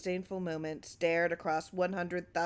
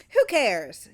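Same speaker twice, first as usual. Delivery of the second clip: loud, brushing it off; dismissive shout; 3 semitones higher, 9 dB louder than usual. Acted. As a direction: snap out a careless retort. Clarence brushing off Glass.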